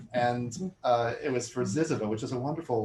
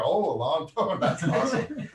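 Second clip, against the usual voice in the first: laughing mock enthusiasm, pitched up; mock cheerful; 7 semitones higher, 6 dB louder than usual. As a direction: imitate a clueless compliment through laughter. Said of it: "people would hear".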